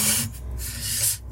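soft unvoiced nervous laugh; breathy laugh. Real, not acted. Vlogger laughs nervously at the knife-wielding girl story. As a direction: let out a quiet breathy chuckle.

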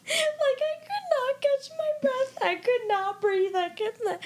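barely getting words out through laughing; laughing at herself for sobbing; laughing high. Real, not acted. Retelling sobbing over the show.

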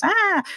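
high imitation of a child's wail; short high-pitched mock scream; mimicked toddler scream. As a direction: cry out like a thwarted toddler. Toddler screaming about toy in bath.

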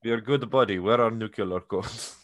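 slow, hammed-up Russian accent; thick mock-Russian accent; full Russian accent. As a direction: imitate a Russian spy faking friendliness. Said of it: "he has the full Russian accent".

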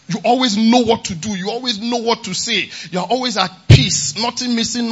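loud, ringing declarations of blessing; full-force preaching. Acted. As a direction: hammer each promise home to the church. Preacher's loud string of affirmations.

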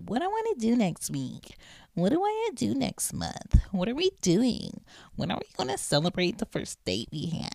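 high, whiny girlish mimicry; mock the clingy girl asking questions. Podcaster mocks young girls' needy questions, high whiny voice. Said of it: "a girl who's looking at".

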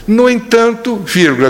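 playful jump up in pitch, dictating the punctuation; mock dictation. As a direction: say it like dictating a formal letter, tongue in cheek. Prays dictating punctuation to God. (ditado brincalhão, tom mais agudo)

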